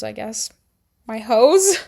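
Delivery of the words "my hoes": "My hoes?" echoed with a rising, questioning tune; incredulous repeat. Real, not acted. Doubting the word she used for her friends, incredulous.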